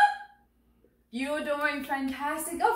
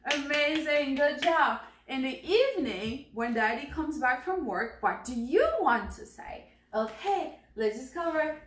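normal talking voice

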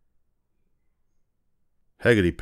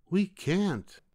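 put-on very high, softer children's voice; the kids answer together, high; children refusing again, very high and soft; children calling; 11 semitones higher, 5 dB quieter than usual.

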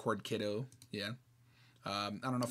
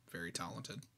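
softer, fading repeat of very talented; quiet echo; 7 semitones lower, 12 dB quieter than usual. Real, not acted. Praising the artist Marmar, repeating it softer. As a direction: let very talented fade to a near hush.

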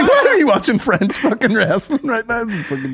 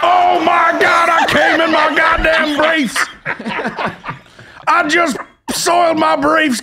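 excited shout; 9 semitones higher than usual. Real, not acted. Fan watching the entrance on stream, shouting excitedly. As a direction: shout it like you can't contain yourself.

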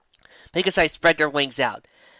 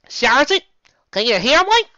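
high, loud radio call; calling over headset; 12 semitones higher, 8 dB louder than usual. Acted. Megan radios the sergeant, loud and high.

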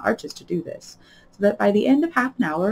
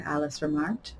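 usual speaking voice

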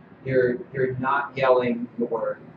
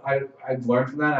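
usual speaking voice

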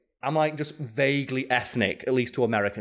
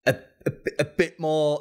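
halting, higher-pitched stammer; awkward hesitation; 4 semitones higher than usual. Retold or imitated. Casting director approaching an actor on set, awkwardly hesitant. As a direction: stumble over the words, unable to finish.

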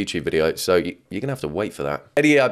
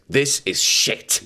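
mock catchphrase; 4 semitones higher, much slower than usual. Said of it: "There's the other catchphrase".

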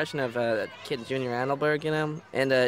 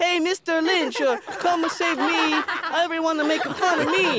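high, mock-pleading kid impression; Junior; 12 semitones higher, 3 dB louder than usual. Boy mimicking classmate calling the teacher, mock pleading. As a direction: call for rescue in an exaggerated high voice. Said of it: "Do a Junior".

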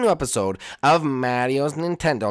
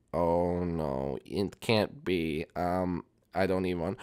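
dismayed; 7 semitones lower, 9 dB quieter, much slower than usual. Acted. Luigi horrified at the mess.

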